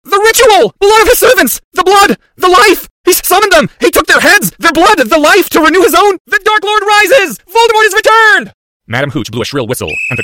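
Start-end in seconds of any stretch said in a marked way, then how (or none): 0.0-8.5 high screamed proclamation, phrase by phrase; shrieking. Acted. Boy crying out a vision of the ritual, shrieking. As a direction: scream each vision out at full force. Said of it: "shrieked even louder".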